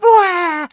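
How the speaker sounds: very high, loud mock crying wail; cartoon wail. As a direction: howl like a sobbing cartoon pirate. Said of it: "Fucking tearing up".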